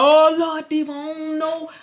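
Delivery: high, fretful "how will I pay" whine; worried debtor voice. Retold or imitated. Viewer mocking a worried debtor to the host. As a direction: act out the panicked person with the bill. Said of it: "You like".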